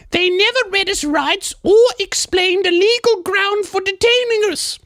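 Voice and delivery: high falsetto English lady; English woman's accent. Acted. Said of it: "English accent as a woman".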